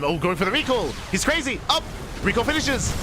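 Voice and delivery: caster's voice jumps high with sudden excitement; excited shout. Real, not acted. Caster shocked by the surprise recall, excited high call. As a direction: yelp it out as the recall hits.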